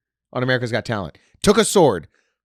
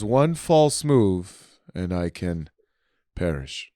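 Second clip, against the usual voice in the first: David Blaine; 5 semitones lower, much slower than usual. Retold or imitated. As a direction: imitate a solemn magician, slow and ominously calm. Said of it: "calm about it".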